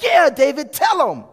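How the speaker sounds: high, loud, rousing shout; bold cheer. Acted. Child egging David on against Goliath, bold shout. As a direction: cheer him on at full voice. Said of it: "you feel this boldness rising up in you".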